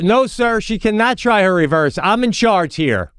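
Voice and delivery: high, indignant mock refusal; imitated officious coach. Coach refusing a dad's request, mock officious indignation.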